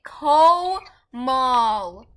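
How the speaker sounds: "Komal" drawn out in two slow, loud syllables; deliberately slow, emphatic "Komal"; over-enunciated name. Real, not acted. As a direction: stretch "Komal" slowly, each syllable on its own. Saying friend's name right after mistakes.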